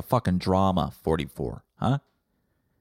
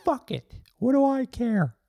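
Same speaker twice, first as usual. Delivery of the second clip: much higher, louder guilt-tripping voice; a sarcastic little number sulking and guilt-tripping; high-pitched passive-aggressive sulk from forty-four; sulky number voice; 4 dB louder than usual. Acted. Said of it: "Forty-four is like".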